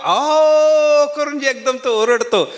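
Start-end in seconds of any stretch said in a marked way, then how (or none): 0.0-1.1 much higher, louder mock donkey ah-oh; loud donkey bray. Preacher braying loudly like a donkey for listeners. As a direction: let out a big donkey bray. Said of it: "केवढ्या जोरात ओरडला होता".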